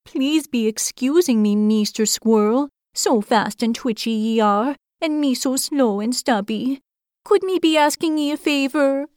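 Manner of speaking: timid hedgehog asking a squirrel a favor; shy hedgehog voice. A narrator's reading. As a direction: plead shyly, curled up tight. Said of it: "in a shy state".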